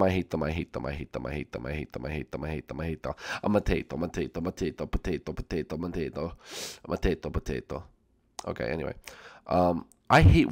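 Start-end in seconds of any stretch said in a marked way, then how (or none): 0.0-3.1 low, soft looping grumble; muttered rant. Real, not acted. Repeats his hate until nonsense. 3.4-7.8 low, soft looping babble; nonsense muttering. Pretend. Rant turns into nonsense chant.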